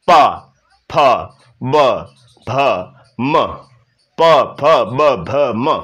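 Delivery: प फ ब भ म with both lips pressed together; slow demo of प, फ, ब, भ, म, drawn out; labial series demo. Shown as a demonstration. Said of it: "दोनों होंठ आपस में टच".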